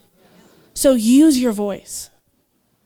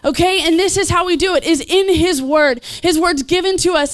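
ordinary talk

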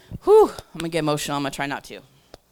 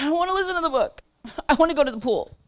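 higher, fast whiny complaint; whiny protest; 7 semitones higher, faster than usual. Retold or imitated. Whining to the Lord like a reluctant kid. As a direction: whine like a kid dodging chores.

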